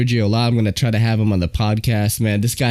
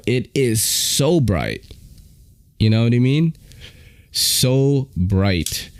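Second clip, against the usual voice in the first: ordinary delivery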